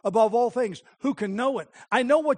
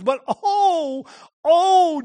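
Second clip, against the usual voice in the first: anguished cry; 6 semitones higher, 8 dB louder, much slower than usual. Acted. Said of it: "Why do you repeat something twice".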